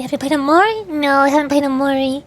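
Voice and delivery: ordinary delivery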